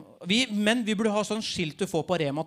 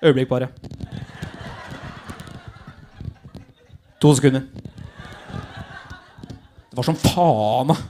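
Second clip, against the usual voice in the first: mock fumbling mutter; 7 semitones lower, 4 dB louder than usual. Fumbling with a bra clasp.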